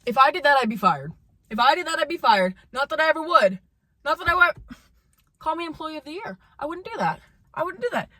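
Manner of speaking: high-pitched, exasperated protest; indignant rant. Real, not acted. Indignant rant about unfair treatment.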